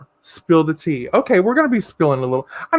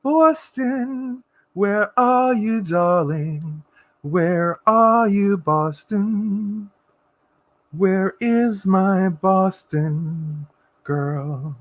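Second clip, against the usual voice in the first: calling out; much slower than usual. Real, not acted. Calling out for the cat.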